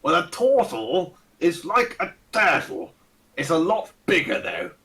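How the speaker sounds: lightly voiced pompous old aristocrat; a hint of a Ronnie Barker toff; pompous old lord. Fat old lord greeting the party, lightly pompous and fussy. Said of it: "with the accent and everything".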